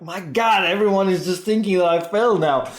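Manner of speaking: a hint of stage fright; panicked inner voice. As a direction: voice your own fear of failing. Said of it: "you'll be like".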